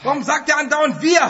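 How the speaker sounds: ordinary delivery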